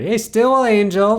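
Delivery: high, loud, slow street shout; calling out. Retold or imitated. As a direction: hail him from across the street.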